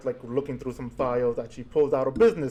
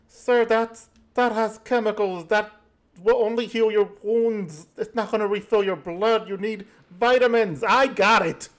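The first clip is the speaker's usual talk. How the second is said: nurse voice; 7 semitones higher, 3 dB louder than usual. Acted. Nurse insisting on vitamins.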